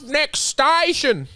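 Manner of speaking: stretched slowly and high, like a station call; drawn-out announcement. Retold or imitated. Repeating a remembered line.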